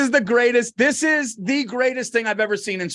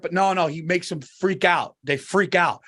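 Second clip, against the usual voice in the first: ordinary talk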